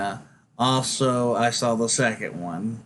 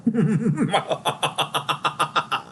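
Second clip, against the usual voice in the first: gleeful villain cackle, pitched up; evil laugh; 5 semitones higher than usual. Acted. Streamer answers cat debate with villain cackle. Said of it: "That was a very evil laugh".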